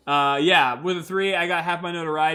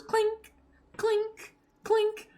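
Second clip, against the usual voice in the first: clinking spurs; much slower than usual. Acted. Spur sound acted out.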